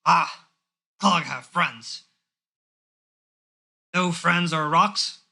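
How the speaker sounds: slow, happy dim orc; slow simple orc, pleased. Acted. Orc meets new friends, slow and happy. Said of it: "Clog kinda happily nods".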